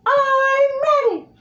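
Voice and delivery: very high, slow pinched squeal; SpongeBob voice. Shown as a demonstration. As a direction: squeeze it up high and tight. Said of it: "then you're doing the high pitch".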